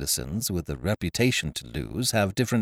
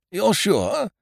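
anxious; 12 semitones higher than usual. A narrator's reading. Nervous Ukridge behind door.

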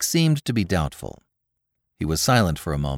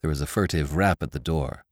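ordinary delivery

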